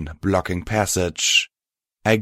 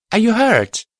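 high, loud question; raised, high-pitched concerned call; asking loudly; 5 dB louder than usual. A narrator's reading. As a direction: call loudly to someone trapped. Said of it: "I asked loudly".

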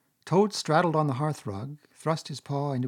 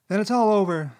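higher, louder, lightly acted sob; sobbing; 8 semitones higher, 7 dB louder than usual. A narrator's reading. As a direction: wail in despair. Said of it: "sobbed the Toad".

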